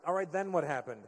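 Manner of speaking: ordinary talk, nothing marked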